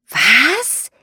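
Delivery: high, loud shocked cry; shocked what. A narrator's reading. Fairy cries out at the old man's refusal, shocked. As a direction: blurt it out in dismay.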